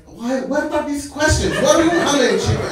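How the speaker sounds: high-pitched, flustered shopkeeper; baffled protest. Retold or imitated. Shopkeeper thrown by odd chicken questions, baffled protest. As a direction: demand to know why, pitched high.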